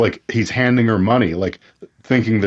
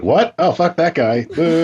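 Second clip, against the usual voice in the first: higher-pitched imitation of indignant spectators; mock heckler, pitched up; turncoat crowd; 7 semitones higher, slower than usual. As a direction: mimic newcomers instantly turning on someone. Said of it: "people who were just introduced to the situation".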